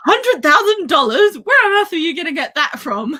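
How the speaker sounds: high-pitched, incredulous retold outburst; mock furious. Retelling Guilt's reaction to the huge wager, mock furious. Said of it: "when he makes him furious".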